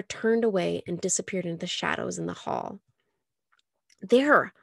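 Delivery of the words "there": usual speaking voice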